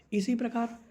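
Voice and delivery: usual speaking voice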